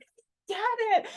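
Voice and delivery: very high, drawn-out squeal of understanding; overwhelmed, laughing-crying revelation. Retold or imitated. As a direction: re-enact the breakthrough, stretching it out high. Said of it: "I was laughing and crying".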